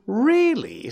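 high astonished "Really?"; amazed. Acted. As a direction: gasp it out in wonder. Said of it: "said Gobo in amazement".

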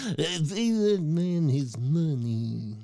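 slow, hammed-up Russian accent; a deliberately bad Russian accent, drawn out. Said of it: "if I do a Russian accent".

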